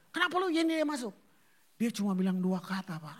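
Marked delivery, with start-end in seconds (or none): 0.1-1.1 fast, loud demand at the guard; angry demand. Retold or imitated. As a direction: fire the question at security. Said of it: "Dia tanya".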